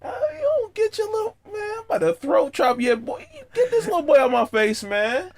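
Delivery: high, strained mock fury; hammed-up threat through laughter; dad pretending to blow up at his son; mock outrage.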